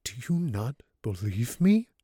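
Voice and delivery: halting, broken question rising at the end; stammering in disbelief, lightly done; stammered plea. A narrator's reading. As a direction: falter through the question, voice lifting on the last word. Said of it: "he stammered".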